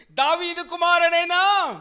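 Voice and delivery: high, drawn-out full shout; loud cry. Acted. Blind man calling Jesus, loud high shout. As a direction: cry out to be heard over a crowd. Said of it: "మిగతాది మీరే గట్టిగా అనండి".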